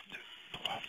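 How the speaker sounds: counting the cards in a whisper; murmured count while dealing cards. Real, not acted. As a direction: whisper the numbers under your breath. Counting cards under breath.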